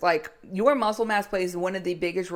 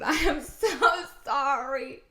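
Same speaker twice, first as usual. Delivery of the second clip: high, giggling sorry; laughing apology; 7 semitones higher, slower than usual. Real, not acted. Coach apologizing to listeners through laughter. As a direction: apologize while still laughing.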